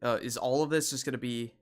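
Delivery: usual speaking voice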